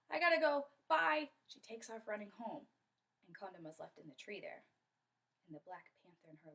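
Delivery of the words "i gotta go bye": high, loud blurted goodbye; alarmed hasty exit. Acted. Friend takes off running home, alarmed goodbye. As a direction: bail out in a hurry.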